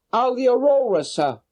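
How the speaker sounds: high voiced woman, a little anxious; worried boatman's wife. Boatman's wife telling Holmes her husband is gone, high anxious voice. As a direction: answer the gentleman with a high, fretful woman's voice.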